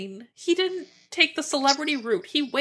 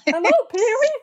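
Pee-wee movie quote; 10 semitones higher, 3 dB louder than usual. Retold or imitated. Quoting Pee-wee movie lines.

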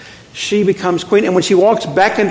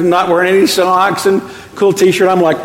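ordinary delivery

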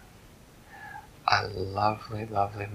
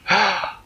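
shocked gasp; 9 semitones higher, 4 dB louder than usual. Acted. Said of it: "Mama said".

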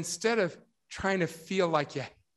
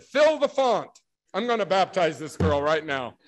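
retold excited command; 2 semitones higher, 7 dB louder than usual. Excited member ordering a baptism for the girl, exclaiming. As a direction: shout it like an urgent joyful order.

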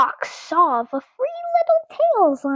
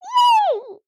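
very high cheer; two little guinea pigs cheering high; cheering yay. A narrator's reading. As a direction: cheer it with delight. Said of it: "said Rosie and Pumpkin".

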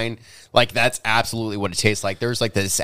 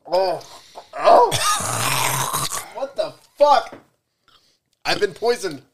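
slow, much higher cry of disgust; mock poisoned; 9 semitones higher, much slower than usual. Real, not acted. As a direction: play it like the drink poisoned you. Reacting to terrible drink taste.

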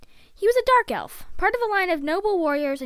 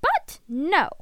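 high, louder sarcastic complaint; indignant grumbling; 7 semitones higher, 3 dB louder than usual. Acted. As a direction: complain as if badly hard done by. Character keeps grumbling about the spider.

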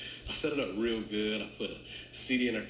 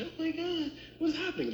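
very high squeaky imitation of excitement; mock excited wife. Husband imitates wife discovering the surprise, mock excited squeal. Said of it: "see her getting excited".

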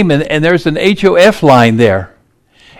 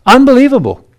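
high, disbelieving burst; incredulous exclamation; 6 semitones higher, faster than usual. Real, not acted. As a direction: sell the outrage in one word. Indignant reaction to German news.

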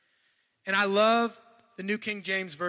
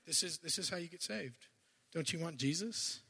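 softer mock sales pitch for Jesus; rote witnessing pitch; 2 semitones lower, 9 dB quieter than usual. Preacher mimicking his old canned pitch to strangers, softly rote. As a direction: lightly imitate a canned gospel pitch. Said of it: "That's how I used to do it".

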